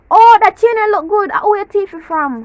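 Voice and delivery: loud, high opener easing off; backhanded compliment. Acted. Jamaican giving someone a compliment, loud mock gushing. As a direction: gush it loudly, then drop into the jab. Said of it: "This is how Jamaican compliment you".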